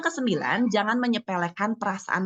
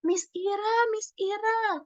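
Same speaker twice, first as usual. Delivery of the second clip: put-on high child voice calling out to the teacher; tattling first-grader; 7 semitones higher than usual. First-grader runs up to teacher in the corridor, imitated high tattling. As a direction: imitate an eager little kid reporting, starting very high. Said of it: "satu anak datang langsung nyamperin saya".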